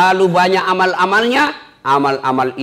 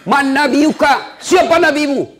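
much higher, stern angel voice; questioning angel; 12 semitones higher than usual. Acted. Angel questions the faithful dead man, high and stern. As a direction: ask it from on high, firm.

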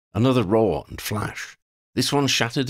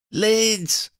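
high-pitched scream of a name; screamed call from the back of a boat. A narrator's reading. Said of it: "Freddie screamed from the stern".

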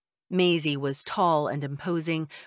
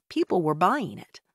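ordinary delivery

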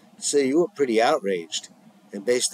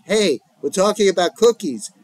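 cutting in loudly; 7 semitones higher, 5 dB louder than usual. Acted. Trying to interject over argument.

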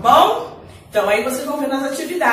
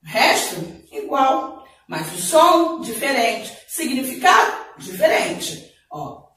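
normal talking voice

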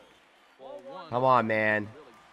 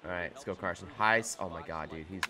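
ordinary delivery

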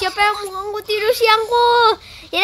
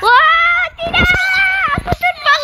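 panicked yelp; 6 semitones higher, slower than usual. Real, not acted. Panic in game chaos. (teriakan panik)

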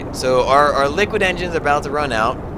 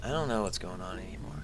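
whispering; 7 semitones lower, 14 dB quieter than usual. Real, not acted. Confused muttering at game.

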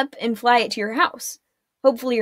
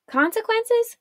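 much higher, startled question; shocked echo; 11 semitones higher than usual. A narrator's reading. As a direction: throw the word back in disbelief. Arella echoes in shock.

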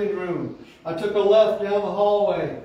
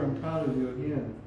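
softer, hushed fatherly words; a father murmuring to his son at the door; retold whisper; 7 semitones lower, 8 dB quieter than usual. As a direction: murmur it close to the ear. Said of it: "whispered in my ear".

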